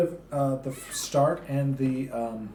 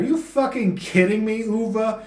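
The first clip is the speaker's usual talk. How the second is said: incredulous; 8 semitones higher, 5 dB louder than usual. Real, not acted. Outraged reaction to the line.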